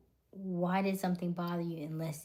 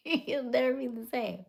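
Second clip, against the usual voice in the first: laughing; 6 semitones higher, 6 dB louder than usual. Real, not acted. Cracks up repeating her line.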